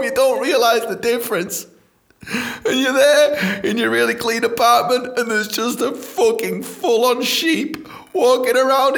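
high, laughing, half-shouted disbelief; cracking up while ranting. Real, not acted. Incredulous laughing rant.

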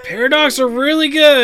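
high, loud burst of enthusiasm; excited agreement. Real, not acted. Host agrees with friend about Paradox, loud and excited. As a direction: jump in with loud, keen approval.

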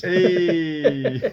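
long put-on shout; mock cheer. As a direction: greet a bad pun with a big hey. Calling out at a bad pun.

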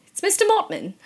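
high-pitched put-on British correction; British butler accent. English tea-bringing butler giving his name, high mock British accent. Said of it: "English tea bringing Mister Mortman".

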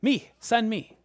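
loud, high, eager; jumping up and down. Pretend. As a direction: bounce with excitement as you offer. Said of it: "jumping up and down".

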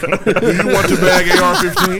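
slow mock machine voice, laughing; a laughing imitation of a checkout machine; self checkout prompts.

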